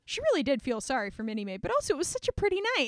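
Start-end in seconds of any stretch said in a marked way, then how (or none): 1.6-2.9 breathless, fast. Retold or imitated. Anne's dreamy excuse, retold.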